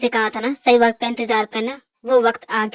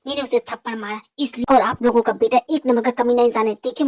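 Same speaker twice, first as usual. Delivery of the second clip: ordinary talk, nothing marked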